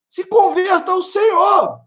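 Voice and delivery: high, slow shouted call to convert; preaching appeal. Real, not acted. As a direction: cry it out to the listener, slowly. Apelo do pregador ao ouvinte. (apelo gritado, agudo e lento)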